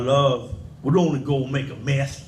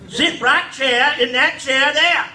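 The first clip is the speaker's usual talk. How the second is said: granny ordering; 8 semitones higher, 4 dB louder than usual. Retold or imitated. Granny ordering the boy to his chair, high and loud. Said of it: "She said".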